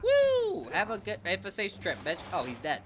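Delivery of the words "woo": high, loud woo; gleeful whoop. Real, not acted. Player throwing someone in the air, gleeful whoop. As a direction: whoop as it goes flying.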